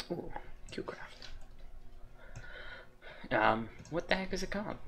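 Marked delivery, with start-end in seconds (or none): 0.1-1.0 whisper. Real, not acted. Whispered aside while playing.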